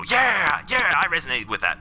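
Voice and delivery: high-pitched imitation of an eager supporter; mock eager agreement. The already persuaded cheering on an angry speaker, mock eager. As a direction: start high on an enthusiastic mimicked yes, then settle. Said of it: "And then they go".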